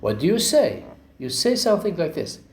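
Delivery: ordinary talk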